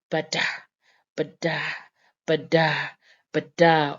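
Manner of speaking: each bada with a short ba and a stressed da; iambic rhythm demo. Shown as a demonstration. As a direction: beat out bada, light first half, weighted second. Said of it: "type of rhythm".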